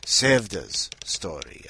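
normal talking voice

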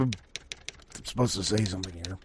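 soft, quick mutter; rushed under-the-breath aside; quiet aside. Real, not acted. Muttered aside about outro.

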